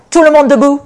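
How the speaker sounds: loud, high rallying cry; shouted command. Acted. Gideon rousing the sleeping camp, loud shout. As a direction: shout it to wake the whole camp. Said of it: "et cria".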